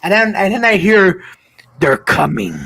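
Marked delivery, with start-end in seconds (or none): 1.8-2.5 very low, creepy put-on voice; imitating a dad's horror-film menace. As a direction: drop deep and drag it out eerily. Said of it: "he comes in really creepy".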